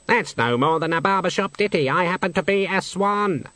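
high, snooty bird voice; haughty swan. Acted. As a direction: sniff at it like a proud swan. Swan speaks.

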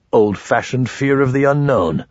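usual speaking voice

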